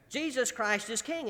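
ordinary talk

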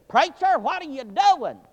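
high, loud, slow challenge; policeman calling out. Retold or imitated. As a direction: call out to the preacher from the squad car. Policeman questioning the preacher.

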